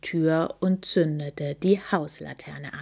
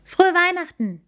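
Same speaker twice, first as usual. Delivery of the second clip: high, loud greeting called back; 12 semitones higher, 5 dB louder than usual. Acted. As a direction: call the wish back happily. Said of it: "riefen Amalie und Fiona zurück". (zurückgerufen)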